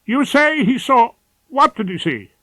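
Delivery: a rattled woman's voice sliding up into shrillness; shrill, rising. Acted. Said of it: "voice slid upwards and became shrill".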